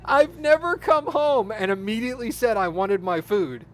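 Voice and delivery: ordinary talk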